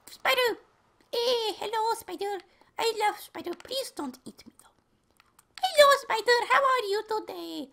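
very high, cutesy falsetto; random squeaky tones. Pretend. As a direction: greet it in a tiny, high put-on voice. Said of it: "moving my voice around in really random tones".

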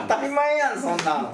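put-on a touch of Kansai accent, high and punchy; mock Osaka dialect. Mock Kansai retort to a co-host. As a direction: throw in some Osaka-style retort. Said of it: "大阪音域ではなかった".

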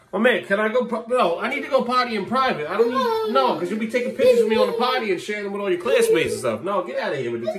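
high-pitched, indignant pleading; exasperated protest. Real, not acted. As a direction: whine in protest at losing your privacy. Dad protests being filmed on potty.

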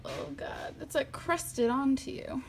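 soft whispered grossed-out remark; whispering at crusted mud; whispered disgust. Real, not acted. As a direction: breathe it out in disgust.

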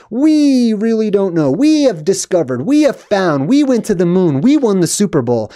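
high, emphatic punch on each We; mock the collective boast, leaning hard on We; stress on We. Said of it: "but they say "we".

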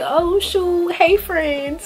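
high-pitched mock surprise at seeing friends; pleasantly surprised greeting. Acting out happy reunion greeting.

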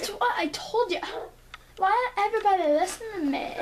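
high exasperated complaint; annoyed no one listens. Real, not acted.

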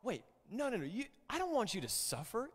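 pleading Peter. Retold or imitated. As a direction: beg him not to go. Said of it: "So Peter said".

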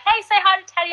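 high, bright mock friend voice; chirpy call to say hi; playful imitation of a friend; imitating friend.

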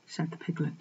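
plain reading voice

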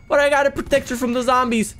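usual speaking voice

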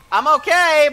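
loud, high and strained; shouting. Real, not acted. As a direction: shout it over the gunfire. Shouting over gunfire after hit.

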